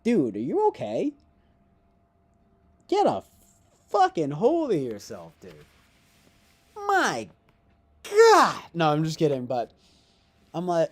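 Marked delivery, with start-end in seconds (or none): 0.0-8.6 hammed-up yell, higher and louder; mock yell. Mocking a crying critic, hammed-up yelling.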